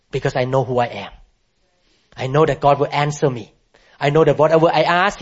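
ordinary talk

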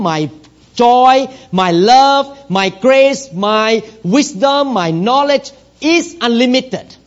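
preacher voicing God to his son, emphatically; God speaking. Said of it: "He said like this".

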